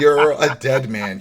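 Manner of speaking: half-laughing mock verdict; laughing mock scold. Real, not acted. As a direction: scold the walker while laughing.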